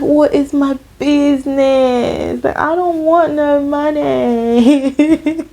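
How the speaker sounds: long drawn-out, high mock whine; exasperated wail. Exasperated inner protest in retold argument.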